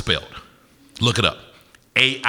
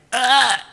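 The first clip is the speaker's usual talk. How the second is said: sudden high gagging yelp; a sharp retching cry, high up; disgusted gasp; 4 dB louder than usual. Retold or imitated. Gagging on drool in mask.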